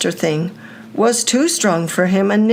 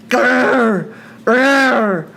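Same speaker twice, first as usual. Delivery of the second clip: monster growl; 3 semitones higher, much slower than usual. Acted. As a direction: growl it like a beast. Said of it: "It growled again".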